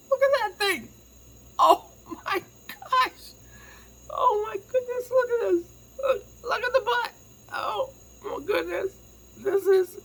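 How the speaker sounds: very high, loud delighted squeal; squealing excitement. Real, not acted. Opening a fan's handmade Winnie gift, squealing with delight.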